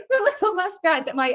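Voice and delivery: words broken by real laughter; laughing. Real, not acted. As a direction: still laughing while speaking. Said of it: "This is so funny".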